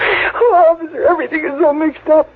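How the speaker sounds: fast, tearful rush; crying. Acted. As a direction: blurt it out while crying. Sister sobbing to officer.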